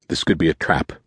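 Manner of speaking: understated grunt of suspicion; grunted warning. A narrator's reading. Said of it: "Nicholas grunted and said".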